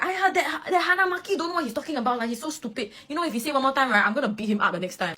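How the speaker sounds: high, fast indignant grumble; indignant friend. Retold or imitated. Vice captain grumbling about Hanamaki to cheer up his crying friend, high and indignant. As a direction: vent on his behalf to cheer him up. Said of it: "he will be like".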